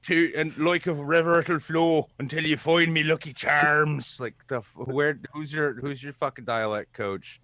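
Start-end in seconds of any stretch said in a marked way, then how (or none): 0.0-4.2 hammed-up, high, loud Irish brogue; mock Irish accent. Host mocks the show's Irish accents, mock Irish accent. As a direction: overdo a bad Irish accent. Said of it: "the worst accents in that was honestly, like, they're the Irish accents".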